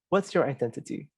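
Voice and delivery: ordinary talk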